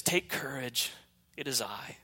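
soft, quiet, calm Jesus voice; calm reassurance. Acted. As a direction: settle their fear gently. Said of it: "a familiar voice say this".

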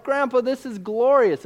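put-on high, wide-eyed child's delight; amazed kid. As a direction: gush like a thrilled little boy. Kids amazed by jumping fish.

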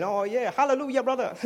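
high, hammed-up American preacher accent; mock American preacher. Imitating the American guy preaching in church, mock American accent. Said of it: "there was an American guy".

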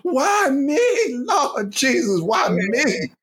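high, crying mock shout of despair; imitating a woman wailing on her knees in Walmart; mock wailing prayer. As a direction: ham up a sobbing plea to heaven. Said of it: "on her knees screaming".

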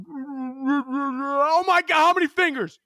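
high, slow, faraway voices as if half-conscious; like hearing people through ringing ears after a hit; dazed muffled voices from far off; muffled, distant voices. Retold or imitated. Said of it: "sounded like this".

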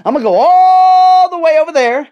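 "all" held long, high and loud; stress on ALL. Real, not acted. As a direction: belt out a long drawn "all". Stretches ALL for emphasis.

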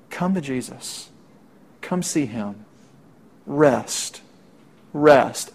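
soft, slow, gentle call to come; softly pleading come and rest; tender invitation. Acted. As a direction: hush down and invite them in.

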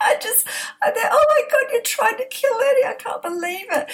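high, breaking with laughter; laugh-crying through the shock; laughing in disbelief. Real, not acted. Mother reacting to daughter's confession, laughing in disbelief.

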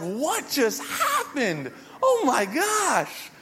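giggling at the absurd fight; laughing. Retold or imitated. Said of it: "we just start dying laughing".

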